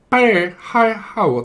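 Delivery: high squawky phone voice; garbled caller. Acted. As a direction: squawk the greeting down a bad line. Garbled caller tries again.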